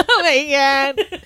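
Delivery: high, slow mock wail; hammed-up weepy despair; mock hysterical crying. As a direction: wail it slowly as if sobbing. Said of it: "like, crying hysterically".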